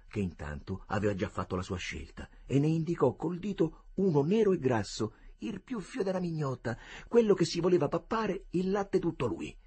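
plain reading voice

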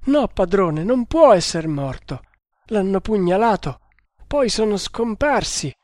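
high, plaintive protest; sobbing. A narrator's reading. Traitor telling his master of the stabbing, sobbing. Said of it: "continuando a singhiozzare".

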